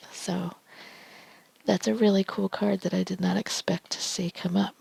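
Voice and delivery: normal talking voice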